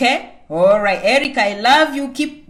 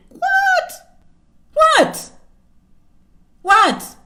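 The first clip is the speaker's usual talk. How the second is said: high incredulous what, rising louder; mock outrage; 5 dB louder, much slower than usual. Speaker mocks complainers with outraged what. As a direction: play it shocked and indignant.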